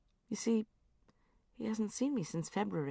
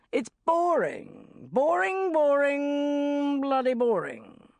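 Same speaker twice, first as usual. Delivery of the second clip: hammed-up boss, slow, higher and louder; Richard Finch; 9 semitones higher, 6 dB louder, much slower than usual. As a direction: drag out each boring like a fed-up editor. Richard Finch ranting at team.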